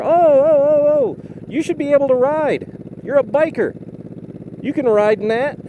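high whiny mock voice; mocking other people. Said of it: "A lot of people are".

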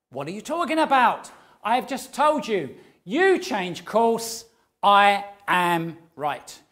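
loud, blustering ship captain refusing to budge; arrogant captain. Acted. Captain shouting back at the radio voice, loud and indignant. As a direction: bellow it like a proud captain who won't give way.

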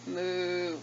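ordinary delivery

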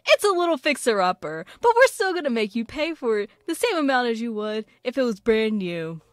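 upbeat salesy realtor pitch; real estate agent voice. Acted. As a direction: sell the fixer-upper cheerfully. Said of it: "sounding like a real estate agent".

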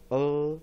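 呃 as a held schwa sound; weak vowel 呃. Shown as a demonstration. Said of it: "补上一个弱母音符号". (示范弱母音“呃”)

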